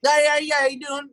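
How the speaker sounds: high-pitched put-on hillbilly accent. Manager calls the bar in disguise, hillbilly accent. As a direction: holler a greeting like a cartoon hillbilly. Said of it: "usually in a random hillbilly accent".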